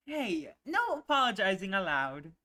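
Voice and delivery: mock stern, playful; playful scolding. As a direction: tease him out of apologizing. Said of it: "a playful swat on the knee".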